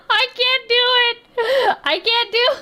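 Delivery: high, squeaky laugh-crying through the words; helpless laughter. Real, not acted. Viewer giving up on the clip, laughing helplessly. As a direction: laugh until it breaks into a whine.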